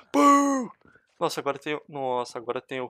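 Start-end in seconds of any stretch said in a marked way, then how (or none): 0.1-0.7 high, loud, long "pum"; cartoonish high boom; imitated gunshot boom. Real, not acted. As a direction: sing out the bang. Imitates shot while firing.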